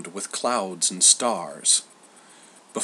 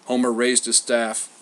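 usual speaking voice

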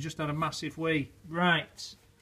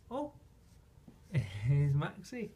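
ordinary talk, nothing marked

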